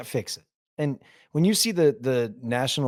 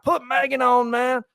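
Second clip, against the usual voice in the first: put-on much higher, loud drunk greeting; drunk buddy; 13 semitones higher, 5 dB louder, slower than usual. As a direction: boom a sloppy hello to the wife. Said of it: "and you're like".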